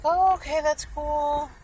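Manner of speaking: high, light imitated acceptance; agreeable cleaner's reply. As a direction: imitate someone cheerfully going along.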